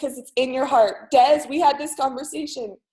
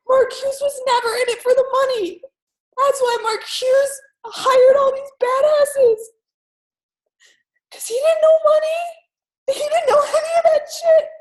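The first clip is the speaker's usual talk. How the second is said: high sobbing cry, swelling louder; crying hard; 12 semitones higher, 4 dB louder than usual. Retold or imitated. Uncle praising the company founder to niece, crying hard. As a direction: wail it with fervour through tears. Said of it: "That's why I'm crying so hard".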